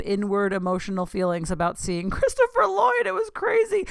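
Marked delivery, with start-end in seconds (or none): 2.1-3.8 much higher and louder, squealing with fangirl glee; giddy squeal. Real, not acted. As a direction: shriek the name, unable to contain the thrill. Gushing about Christopher Lloyd.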